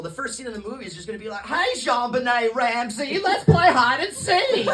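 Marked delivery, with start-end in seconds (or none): 1.5-4.7 slow, loud, higher Forrest Gump Southern drawl; hammed-up slow Southern Gump voice; Forrest Gump accent. Comic does the Forrest Gump accent for the joke, slow loud Southern drawl. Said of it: "you can't do that accent".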